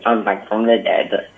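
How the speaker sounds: badly faked character voice; lightly put-on voice from beyond the grave; half-hearted bad impersonation; Stephen Hawking. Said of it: "your bad accent and your bad character".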